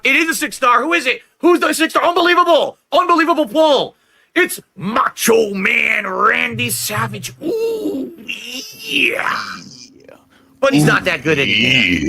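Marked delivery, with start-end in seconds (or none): 0.0-4.0 escalating high hype; pack opening hype. Real, not acted. 4.4-9.4 slow, very high put-on ooh yeah; hammed-up wrestler catchphrase; Macho Man impression.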